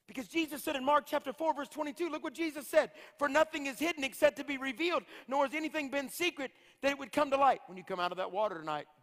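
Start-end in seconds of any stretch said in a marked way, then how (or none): 0.1-7.6 high, ringing preacher's proclamation; scripture proclamation. Real, not acted. Preacher quoting Jesus from Mark to the church, proclaiming. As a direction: lift the voice and proclaim the verse.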